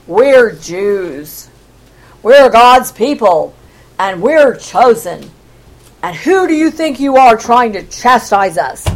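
low, weighty proud declaration; haughty indignation. Acted. Chosen people rebuking Isaiah, haughty and indignant. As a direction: pull rank on the upstart prophet.

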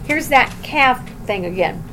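usual speaking voice

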